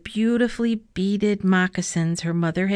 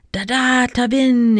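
the ice rumbles its words, louder; louder call from the waking ice; rumbling ice voice; 4 semitones higher, 5 dB louder than usual. A narrator's reading. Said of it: "it rumbled".

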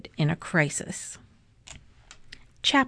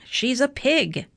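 higher, mildly shouted insistence; narrated outburst, pitch raised; shouting; 6 semitones higher than usual. A narrator's reading. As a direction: insist in a mild storybook shout. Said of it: "shouted Eugenia".

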